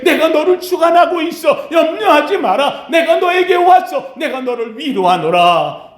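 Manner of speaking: Jesus booming his reassurance; fervent reassurance. Acted. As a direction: preach his promise up at her with rising force.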